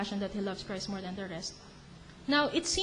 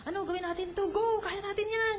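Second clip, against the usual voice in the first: higher, bouncy rallying cry; rally your friends like an excited kid; eager kids; 9 semitones higher than usual. Retold or imitated. Youngsters urging each other on, high and eager. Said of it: "Pero yung mga bata".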